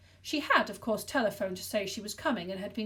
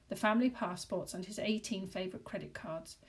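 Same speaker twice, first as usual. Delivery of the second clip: plain reading voice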